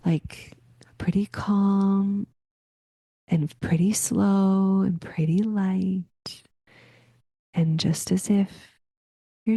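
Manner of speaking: whispered, slow and airy; calm, light whisper. Shown as a demonstration. Showing listeners her meditation voice, slow and whispered. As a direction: let the words float out in a slow whisper. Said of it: "my voice is going to be pretty".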